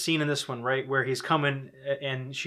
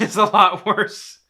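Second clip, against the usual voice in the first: laughing while agreeing; 7 semitones higher, 10 dB louder, slower than usual. Real, not acted. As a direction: say it through a laugh.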